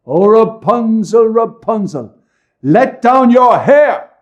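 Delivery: high ringing call, drawn out slowly; loud call. A narrator's reading. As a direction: cry it up to the window, loud and high. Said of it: "she called out".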